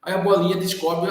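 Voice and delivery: ordinary delivery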